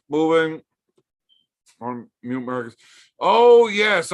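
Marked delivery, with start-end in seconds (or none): 3.2-4.1 loud, high burst of yes; excited yell. Real, not acted. Streamer suddenly remembering a chat request, excited yell. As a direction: blurt it out like you just remembered.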